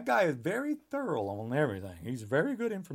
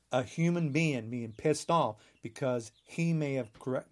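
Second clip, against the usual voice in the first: ordinary delivery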